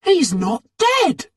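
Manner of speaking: high, slow relieved cry; a boy relieved his neighbour is alive; happy. A narrator's reading. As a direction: burst out with relief. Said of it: "said D J happily".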